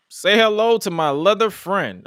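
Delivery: hammed-up imitation of a Scarface line; Scarface quote. Co-host mimicking a wrestler's botched Scarface quote, hammed-up. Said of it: "he was trying to quote Scarface".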